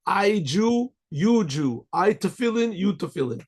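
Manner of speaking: lightly imitated broken English, each phrase starting high; halting English speaker. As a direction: act out someone with only four words of English. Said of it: "he didn't speak English that well".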